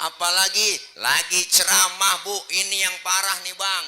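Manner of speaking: high, very loud declaiming; impassioned preaching. Real, not acted. Preacher telling the congregation of Taif, impassioned preaching. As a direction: drive each phrase home at full force.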